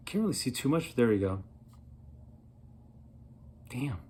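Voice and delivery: ordinary talk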